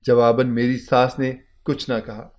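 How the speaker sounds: ordinary delivery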